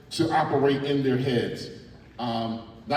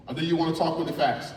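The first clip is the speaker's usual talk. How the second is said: ordinary delivery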